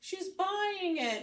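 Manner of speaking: high, loud, drawn-out squeal to coworkers; excited cashier. Retold or imitated. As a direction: mimic someone excitedly calling her friends over.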